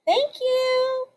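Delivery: high, sugary fake thank-you; mock-sweet thanks. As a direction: end the jab with syrupy politeness. Sarcastic sign-off to Tiffany.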